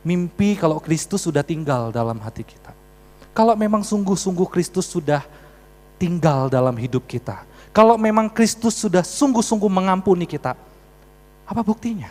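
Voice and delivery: loud, emphatic pulpit challenge; forceful preaching. Real, not acted. Preacher challenging the congregation about their faith, forceful preaching. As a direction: drive each clause home, loud, with pauses between.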